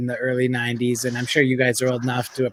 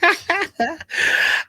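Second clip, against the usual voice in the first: laugh. Real, not acted. Laughing at the vaccine joke.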